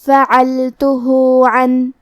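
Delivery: فعلتہ with the ہ vowel stretched for two counts, very slow; two-count madd. Shown as a demonstration. Teacher demonstrating madd silah to students, slow drawn recitation. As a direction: lengthen the end of فعلتہ before عن. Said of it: "اپنی آواز دو مد کے برابر لمبی کریں گے".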